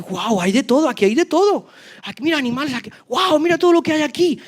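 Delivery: high, delighted gasps at the loot; amazed wonder. Acted. Lepers marvelling at the abandoned Syrian camp, amazed and high. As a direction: marvel at every treasure, pitch climbing.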